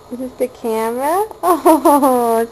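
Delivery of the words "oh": delighted laugh. Real, not acted. Parent laughing as the baby finally smiles, real delighted laugh. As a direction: burst of loud laughter.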